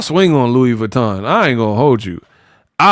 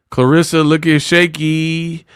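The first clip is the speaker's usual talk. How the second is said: normal talking voice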